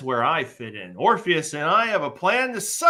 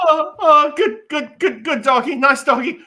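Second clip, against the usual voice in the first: high, loud, jittery sweet-talk with a scared laugh; nervous coaxing; 14 semitones higher, 8 dB louder than usual. Acted. Joxer dangling from branch, nervously sweet-talking the wolf. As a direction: soothe the dog while terrified.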